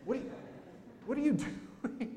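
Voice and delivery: high, half-laughing alarmed protest; new parent fending off a stranger, high mock alarm; protective parent. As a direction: pull back, mock horrified at germy hands. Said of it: "And you're like".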